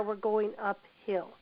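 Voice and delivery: ordinary delivery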